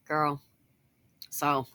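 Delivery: ordinary delivery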